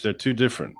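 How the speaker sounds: normal talking voice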